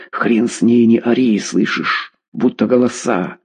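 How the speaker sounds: ordinary delivery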